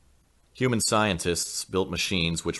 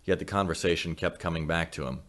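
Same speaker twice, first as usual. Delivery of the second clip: plain reading voice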